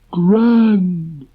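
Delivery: high, slow gloomy donkey; Eeyore voice. Acted. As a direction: drag it out like a glum donkey. Eeyore's sarcastic remark.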